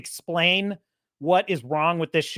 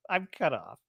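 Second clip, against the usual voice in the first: much softer and lower; a quiet mock-sad aside; muttered aside; 4 semitones lower, 9 dB quieter than usual. Real, not acted. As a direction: drop it under your breath. Mock alarm at being cut off.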